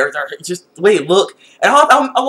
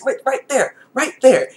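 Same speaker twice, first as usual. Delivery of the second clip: usual speaking voice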